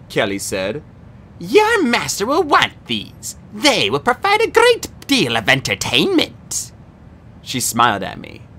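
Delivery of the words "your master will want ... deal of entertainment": slow, sneering, high villain voice; menacing monster cheerleader. A narrator's reading. Kelly taunts Percy about his mortal friend, slow and sneering. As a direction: purr the threat, savouring it.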